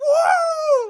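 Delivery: high, loud whooping yell; imitated triumphant holler; Han Solo yell. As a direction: whoop at full voice, drawn out. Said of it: "screaming out through that Han Solo yell".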